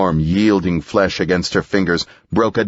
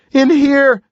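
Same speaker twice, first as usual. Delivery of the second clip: high, loud call for help; crying out; 6 dB louder than usual. A narrator's reading. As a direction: shout for help down the hall. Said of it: "she cried".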